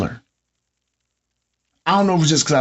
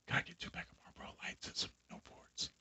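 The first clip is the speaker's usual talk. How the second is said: unvoiced whisper, far softer than usual; barely audible mumbled order; 29 dB quieter than usual. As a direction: imitate a customer gone real quiet at the register. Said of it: "get real quiet after you've been yelling".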